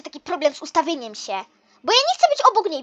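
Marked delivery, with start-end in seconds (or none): 1.8-2.8 whiny protest. Acted. Protesting standing next to her.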